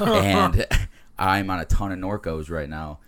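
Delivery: laughing through the words; giggling while speaking. Real, not acted. Laughing at his own story.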